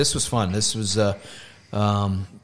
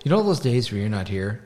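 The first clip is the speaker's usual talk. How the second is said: ordinary talk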